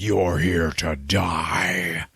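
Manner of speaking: very slow, rough monster voice; menacing giant demon, words stretched out; Dormammu. Retold or imitated. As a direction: pronounce doom as a huge evil entity. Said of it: "And he's like".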